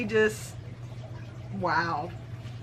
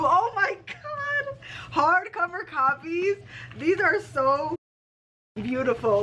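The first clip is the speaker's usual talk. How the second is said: pitched way up, overjoyed; awed excitement; 7 semitones higher than usual. Real, not acted. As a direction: gush over a treasured gift. Thrilled at the hardcover copies.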